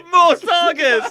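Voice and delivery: loud, slow, high hype; excited announcer. Acted. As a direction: bellow it like a promo. Announcer parody.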